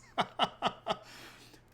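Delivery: high, staccato laughter; high string of ha-ha-has; laugh at own joke. Real, not acted. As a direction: laugh in quick high bursts. Laughing after joke about Japanese.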